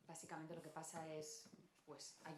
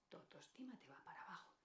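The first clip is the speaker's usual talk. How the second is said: sinking self-esteem; 7 dB quieter, faster than usual. Shown as a demonstration. Speaker showing how self-esteem falls, hushed sinking voice. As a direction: voice drops away almost to a whisper.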